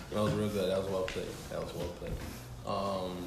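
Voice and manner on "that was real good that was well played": usual speaking voice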